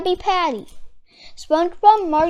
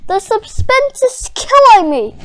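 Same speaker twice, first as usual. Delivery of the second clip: higher, louder fretful cry; anxious cry; 7 semitones higher, 8 dB louder than usual. Acted. Mister Krabs waiting outside, crying out anxiously. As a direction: cry out in suspense. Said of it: "cried Mister Krabs".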